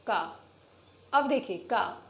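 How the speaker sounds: का with a long, clear आ; long aa demo. Shown as a demonstration. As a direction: stretch the आ in का. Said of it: "आ की आवाज़ सुनाई दे रही है". (का में आ की लंबी ध्वनि)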